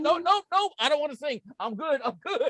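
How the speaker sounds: high, backing-off mock refusal; nervous refusal. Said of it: "They're like".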